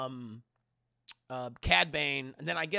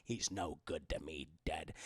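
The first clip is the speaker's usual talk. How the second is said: much lower, gravelly bounty hunter voice; gravelly Boba Fett; 13 semitones lower, 9 dB quieter than usual. Shown as a demonstration. Streamer repeating Boba Fett's line, low and gravelly. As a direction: growl it low and cold. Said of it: "I always like that voice".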